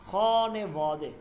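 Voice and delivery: খনে বদে drawn out very slowly, syllable by syllable; slow demo. Shown as a demonstration. Teacher models the Persian word for students, very slowly. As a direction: stretch খনে বদে so students hear every sound. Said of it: "এটার উচ্চারণটা আমরা দেখি".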